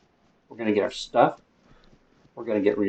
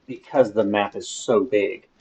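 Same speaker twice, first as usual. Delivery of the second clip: ordinary talk, nothing marked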